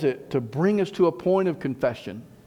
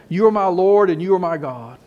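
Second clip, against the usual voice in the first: fervent confession; 3 semitones higher, 6 dB louder than usual. Acted. Congregational confession voiced.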